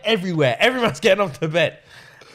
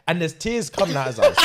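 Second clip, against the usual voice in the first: ordinary delivery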